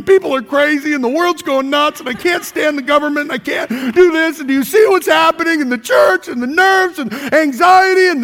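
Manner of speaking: fast, rising frantic complaining rant; ranting complainer. Retold or imitated. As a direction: rattle off gripes like a frantic whiner. Mimics complainer prayer.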